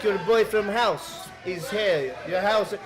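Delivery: put-on Eastern European accent. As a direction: explain slowly in mock Eastern European English. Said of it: "default Eastern European accent".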